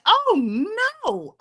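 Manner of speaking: high "oh" dropping away on "no"; mock-horrified outcry; appalled. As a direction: retell your horror, starting way up high. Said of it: "I was appalled".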